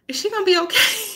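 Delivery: high-pitched mock concern; retold worried question. Teacher retelling her worry about a student, mock worried. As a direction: raise it high, ending on a questioning lift.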